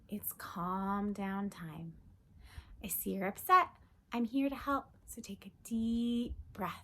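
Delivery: slow, sing-song picture book read-aloud; reading to children. Acted. Teacher reading a calm-down book to kids, sing-song. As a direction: read it gently to a little one.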